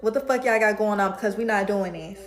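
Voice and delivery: loud furious callout; angry confrontation. Retold or imitated. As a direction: shout her down, blacking out with anger. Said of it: "I said".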